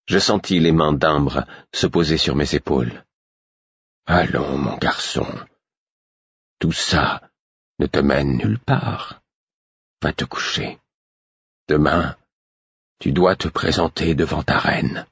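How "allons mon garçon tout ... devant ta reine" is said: put-on calm the boy down gently; patient. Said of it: "avec beaucoup plus de patience".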